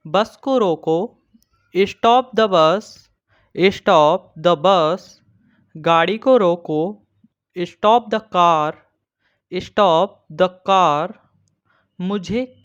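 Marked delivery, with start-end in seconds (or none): 1.8-5.0 slow teaching read. Shown as a demonstration. Teacher reading stop the bus slowly for students. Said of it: "Daily use English short sentence". 7.6-11.1 slow teaching read. Shown as a demonstration. Teacher reading stop the bus slowly for students. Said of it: "Daily use English short sentence".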